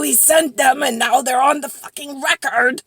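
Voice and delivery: loud, rising complaint; fed up, voice pushed hard; exasperated. Real, not acted. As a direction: vent in frustration. Exasperated complaint about the photos.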